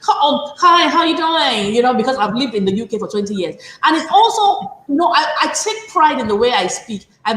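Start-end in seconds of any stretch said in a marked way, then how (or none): 0.1-1.8 louder, higher mock British accent; exaggerated greeting in a put-on UK accent; put-on phonics accent. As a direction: imitate an affected expat accent. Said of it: "they start speaking Fone because they are expecting".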